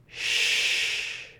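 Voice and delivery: soft, drawn-out "Sh" through rounded lips; sh sound. Shown as a demonstration. Teacher shows learners the sh sound, soft hush. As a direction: blow a long, voiceless "Sh", mouth slightly open. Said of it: "leaving your mouth slightly open".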